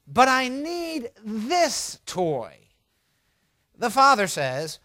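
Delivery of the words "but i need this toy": slow, high whine, words stretched out; whining little girl. Acted. Daughter insisting to her father on one toy, whining. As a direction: plead in a drawn-out child's whine. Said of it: "The daughter whines".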